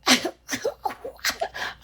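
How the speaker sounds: high breathless laugh. Real, not acted. Mother cracking up at her toddler's word, high airy laugh. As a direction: let a squeaky silent laugh out.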